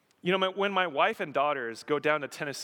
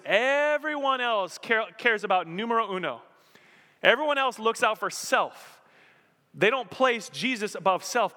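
emphatic preaching; 9 semitones higher, 6 dB louder than usual. Real, not acted. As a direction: pound the point home from the pulpit. Preacher stressing selfishness.

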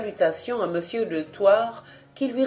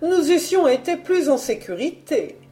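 higher, nervous frightened chatter; 6 semitones higher than usual. A narrator's reading. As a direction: pour it out, haunted and on edge. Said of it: "un sursaut d'épouvante".

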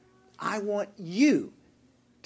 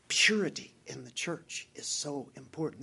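whisper; 3 semitones lower, 4 dB quieter than usual. Real, not acted. Preacher drops to a whisper.

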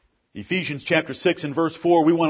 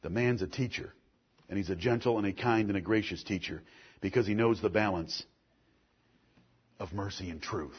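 much softer, lower, intimate sermon voice; quiet earnest preaching; 8 semitones lower, 10 dB quieter than usual. Real, not acted. Pastor quietly rebuking the men who hurt children. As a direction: lean in and press it softly.

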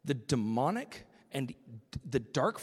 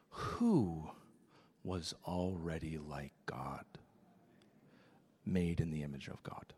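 hushed whisper, much softer; 10 semitones lower, 6 dB quieter than usual. Real, not acted. Preacher whispering to the congregation about being made like God. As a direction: lean in and whisper the point. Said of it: "preacher drops to whisper".

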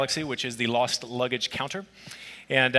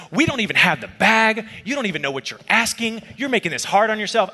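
higher, louder irritated customer-service rant; a retold phone agent going off, raised and sharp; angry rant; 7 semitones higher, 3 dB louder than usual. Retold airline agent scolding the pastor, angry. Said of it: "he's at about an eight out of ten".

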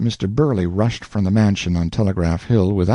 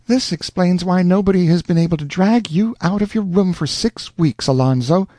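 teasing mother's voice; 9 semitones higher than usual. Acted. Mother teases her son.

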